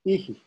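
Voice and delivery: "ihi" with a released h before the repeated vowel; breath echo. Shown as a demonstration. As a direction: say "ihi" as a short chanted tone and its echo. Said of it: "and that is that echo".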